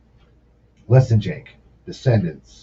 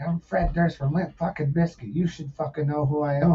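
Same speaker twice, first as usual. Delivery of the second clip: higher-pitched mock self-importance; 7 semitones higher than usual. Singer announcing himself to a stranger, mock self-important. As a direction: imitate someone demanding to be recognized. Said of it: "He's like".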